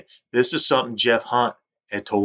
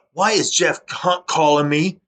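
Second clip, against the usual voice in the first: retold yelling; 7 semitones higher, 4 dB louder, slower than usual. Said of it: "starts yelling at me".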